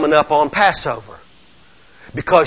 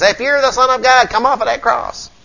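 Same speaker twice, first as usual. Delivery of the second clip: higher-pitched taunt at the crucified Jesus; mocking jeer; 8 semitones higher than usual. Preacher voicing those who mock Jesus, jeering. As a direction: jeer it like the devil's mocking crowd. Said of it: "they, they mock Jesus".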